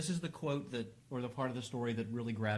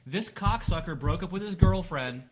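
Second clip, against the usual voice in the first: outraged; 2 semitones higher, 7 dB louder than usual. Real, not acted. Outraged aside breaking documentary read.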